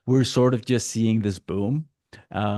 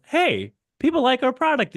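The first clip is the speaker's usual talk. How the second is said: much higher, delighted discovery; mock surprised seller. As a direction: play a seller amazed by good news. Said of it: "very often they'll go".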